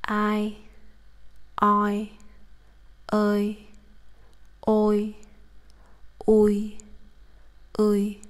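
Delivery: each diphthong "I", "ơi", "ôi", "ui" said slowly and separately; Vietnamese diphthongs. Shown as a demonstration. Teacher listing Vietnamese diphthongs to students, careful and clear. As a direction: model every vowel glide clearly for learners. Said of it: "They are".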